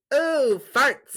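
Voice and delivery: delighted little daughter. Retold or imitated. Daughter laughs at father's farting, gleeful high imitation. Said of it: "My daughter laughs and says".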